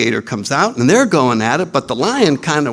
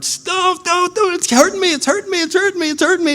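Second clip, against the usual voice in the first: much higher, frantic squeal of a victim; high squealing plea; faster than usual. Retold or imitated.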